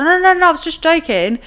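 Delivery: high, loud, imitated protest; mock-frantic excuse from someone caught out; panicked backpedalling. Cornered boy excuses himself to the older guy, frantic.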